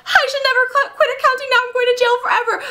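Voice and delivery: very high, frantic mock wail; hammed-up hysterics, speeding up; panic attack. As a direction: squeal it in pretend terror. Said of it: "starts having a panic attack".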